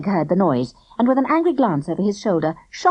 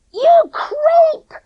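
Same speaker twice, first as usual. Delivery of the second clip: very high, loud insult; bellowed; 7 dB louder than usual. A narrator's reading. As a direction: bellow it over her shoulder. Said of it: "Clipper bellowed".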